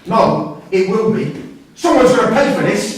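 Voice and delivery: loud, rising threat; furious, vowing revenge; angry outburst. Acted. Angry father over son's fight.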